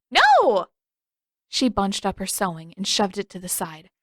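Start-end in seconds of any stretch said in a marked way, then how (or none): 0.1-0.7 high, loud outraged shout. Acted. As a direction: shout it down flat out. Katara refusing the burping contests.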